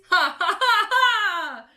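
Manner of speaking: high, loud mischievous cackle; cackling laugh. A narrator's reading. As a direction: cackle in triumph. Peeves laughs.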